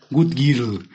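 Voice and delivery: put-on "Good girl" with a heavy Mexican Spanish accent, "gut girl"; bad English accent. TikTok guy who can't speak English, mocked with a bad accent. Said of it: "se notó que no sabe inglés".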